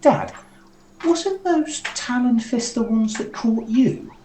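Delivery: put-on high, slow child-to-dad voice; small high-voiced character questioning a parent; Coven. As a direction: pitch it way up, asking Dad eagerly. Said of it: "Coven turns around to Brock and goes".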